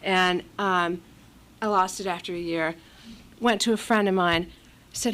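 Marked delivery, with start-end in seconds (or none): none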